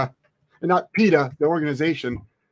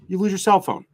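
ordinary talk, nothing marked

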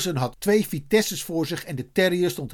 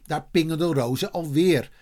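usual speaking voice

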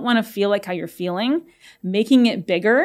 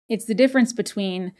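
normal talking voice

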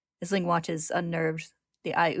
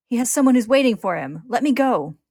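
loud, high plea; forceful, pitched-up yell; desperate shout; 6 semitones higher, 11 dB louder than usual. A narrator's reading. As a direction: shout while fighting to get free. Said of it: "Ed shouts".

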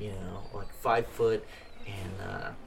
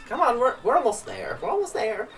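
much higher, louder chirpy little man's voice; tipsy little man; 11 semitones higher, 6 dB louder than usual. Acted. Thrown-out little drunk thanking the dwarf, high and loud. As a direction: play a cheerful drunk runt, pitched way up.

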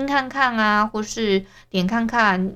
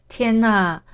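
ordinary talk, nothing marked

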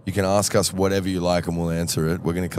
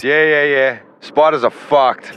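higher, louder, slow drawn-out tradie voice; Michael's voice; 7 semitones higher, 6 dB louder, slower than usual. Retold or imitated. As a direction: lean into the words like a laid-back bloke. Impersonating Michael.

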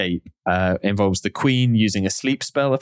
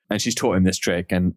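usual speaking voice

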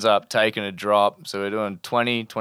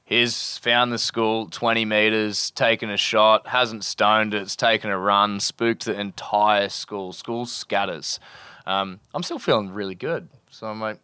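ordinary delivery

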